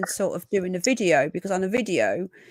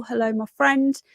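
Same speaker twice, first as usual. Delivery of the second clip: ordinary delivery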